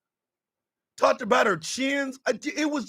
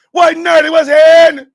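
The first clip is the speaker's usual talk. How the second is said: sudden loud yell of the quote; hammed-up yelling quote; mock shout; 6 semitones higher, 14 dB louder than usual. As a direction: blast it out like the guy ranting. Quoting the tirade, shouted.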